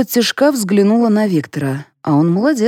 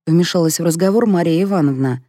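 usual speaking voice

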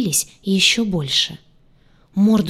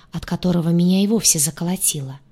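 plain reading voice

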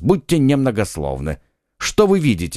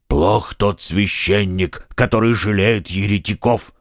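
Porthos's voice; 3 semitones lower than usual. A narrator's reading. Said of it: "произнёс Портос".

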